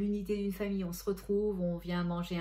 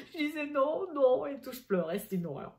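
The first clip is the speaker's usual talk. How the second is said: laughing while retelling; 7 semitones higher, 4 dB louder than usual. Real, not acted. Recalling tearful moment, laughing.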